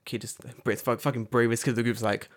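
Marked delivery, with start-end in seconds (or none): none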